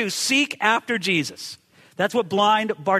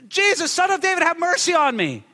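high-pitched pleading call; mild mock scream for mercy; acted desperate cry; 9 semitones higher than usual. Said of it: "a scream, almost an animal cry".

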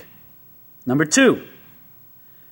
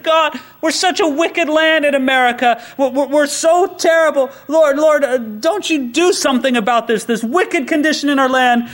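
a hint of a whiny pleading believer, raised pitch; mock pleading prayer; 5 semitones higher than usual. Believer crying out to God about America, mock pleading. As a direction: beg the Lord, a bit hammed-up.

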